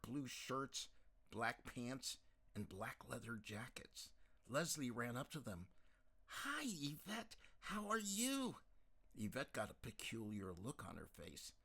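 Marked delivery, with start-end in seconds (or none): 6.3-8.6 much higher, slow bright hello; high, eager girlish greeting. Acted. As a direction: gush at a friend you spotted. Leslie eagerly greets an old friend.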